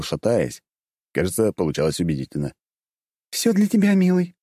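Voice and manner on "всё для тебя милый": high, sugary and smiling; sweet girl voice. A narrator's reading. Izel sweetly answers the hero. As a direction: coo it with a radiant smile. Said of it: "лучезарно улыбнулась Изель".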